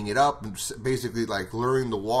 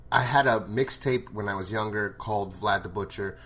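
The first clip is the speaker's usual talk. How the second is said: normal talking voice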